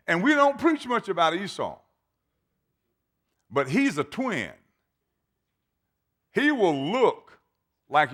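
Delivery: high, loud, hammering sermon voice; full-force preaching. Acted. Preacher driving the point home to his church, fervent. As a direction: preach it to the back row.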